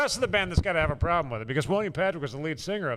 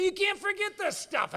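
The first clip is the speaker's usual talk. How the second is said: much higher, slow, put-on voice; high mock voice; 13 semitones higher, 3 dB quieter, slower than usual. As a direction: say it again high and drawn out. Repeats the line in a put-on voice.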